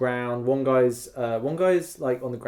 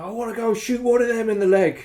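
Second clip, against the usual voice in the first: eager revenge; 9 semitones higher, 4 dB louder than usual. Retold or imitated. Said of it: "I'm like".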